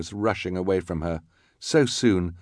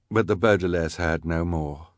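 usual speaking voice

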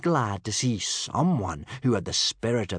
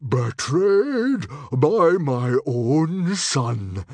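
slow, loud, higher-pitched outrage; fuming; 7 semitones higher, 9 dB louder, much slower than usual. A narrator's reading. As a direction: thunder it out as a betrayed father, word by word. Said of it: "fumed Stoic the Vast".

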